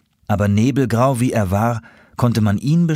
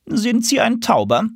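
stern, much higher pigeon voice; strict voice. A narrator's reading. Pigeon challenging the newcomer on the roof, strict and high. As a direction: ask it like a strict official. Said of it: "fragte eine strenge Stimme".

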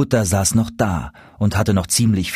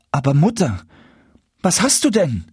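high-pitched, alarmed question; startled; 7 semitones higher than usual. A narrator's reading. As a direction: ask your mother in shocked bewilderment. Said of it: "fragte Jakob ganz erschrocken". (erschrocken, mit hoher Stimme gefragt)

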